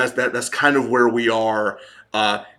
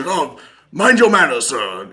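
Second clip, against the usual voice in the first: mock officer's bark, starting high and loud; acted scolding that drops on the last word; barked reprimand; 4 semitones higher, 4 dB louder than usual. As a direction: bark a mock rebuke at a subordinate. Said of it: "all these people kind of barking".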